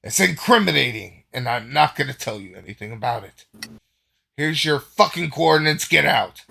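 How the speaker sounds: indignant dismissal, building to a shout; flustered anger. Acted. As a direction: snap back loudly and throw them out. Embarrassed Gain angrily throws Delgar out.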